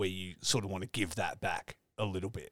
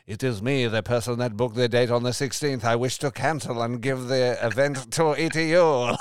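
mock French accent; 4 semitones higher, 9 dB louder than usual. Cohost imagining Ryan calling in disguise, loud hammed-up French accent. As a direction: play a disguised Frenchman badly faking it. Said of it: "I picture you with a beret on".

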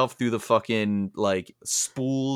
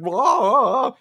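very high, loud silly bleat; sudden high honking Dugong noise; Dugong cry; 13 semitones higher, 7 dB louder than usual. Retold or imitated. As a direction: blurt it like a goofy sea creature. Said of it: "it's like".

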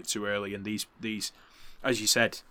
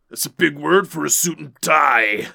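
loud gravelly wrestler promo voice; Macho Man impression; 5 semitones higher, 10 dB louder than usual. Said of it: "which Macho says".

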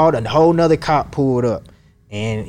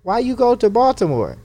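lightly voiced doctor, pitched higher; a hint of the puzzled doctor's voice; 9 semitones higher than usual.

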